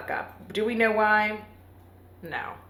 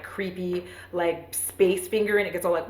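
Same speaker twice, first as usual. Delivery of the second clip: ordinary delivery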